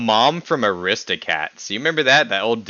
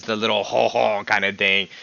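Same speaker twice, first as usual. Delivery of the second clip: ordinary delivery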